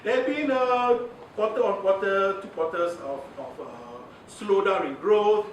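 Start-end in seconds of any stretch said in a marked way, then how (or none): 1.3-5.5 careful official phrasing. Shown as a demonstration. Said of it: "That's how they, they will say it".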